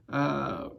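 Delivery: usual speaking voice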